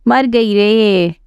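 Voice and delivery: drawn-out, lightly voiced cry of pain; mild mock cry of pain. A narrator's reading. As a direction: stretch the words out slowly like a small wail. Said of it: "तभी वो चिल्लाई".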